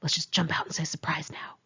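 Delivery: hushed, breathy whisper from hiding. Retold or imitated. Missy to me, crouched in the box, whispering. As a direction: whisper so nobody inside hears. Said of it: "Missy whispered".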